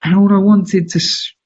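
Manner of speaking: ordinary talk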